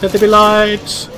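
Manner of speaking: high, slow mock-grand announcement; grand proclamation. Showing off the lantern, in a mock-grand high proclamation. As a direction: proclaim it like a creation decree.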